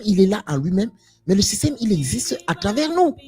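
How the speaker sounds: normal talking voice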